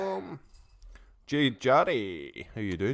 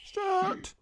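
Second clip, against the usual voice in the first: Stuart squeaked out much higher, in a falsetto; squeaky high repeat. Real, not acted. Greeting viewer Stuart, then again higher.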